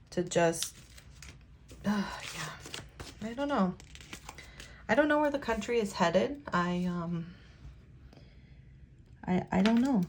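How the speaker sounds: soft, halting, sighing; quiet despair over the country; subdued and sad. Real, not acted. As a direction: hold back tears, trailing off. Emotional, at a loss.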